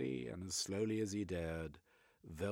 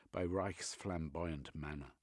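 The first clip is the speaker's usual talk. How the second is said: ordinary delivery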